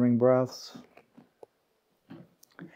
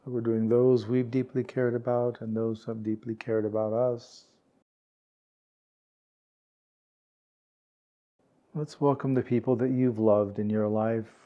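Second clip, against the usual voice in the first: calm, unhurried meditation guide, words spaced by long pauses; guided meditation; 2 semitones lower, 5 dB quieter than usual. Real, not acted. Meditation teacher guiding warm-hearted peers to relax, calm and unhurried. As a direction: gently lead a sitting group into stillness, leaving space.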